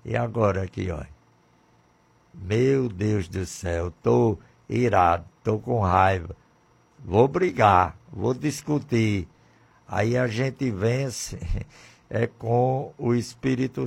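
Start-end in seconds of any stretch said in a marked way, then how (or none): none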